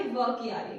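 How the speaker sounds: stressed व at the start, said low; व voiced clearly and low; sound of व. Shown as a demonstration. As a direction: spell out the व sound. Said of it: "जो आवाज आ रही है".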